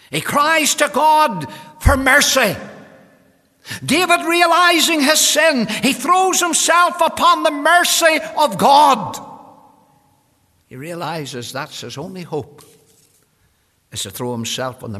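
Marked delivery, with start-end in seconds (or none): 0.1-9.2 loud, high, drawn-out pulpit cry; full-force preaching. Real, not acted. Preacher tells congregation of David pleading for mercy, full-force preaching. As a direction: preach it at full volume, stretching key words.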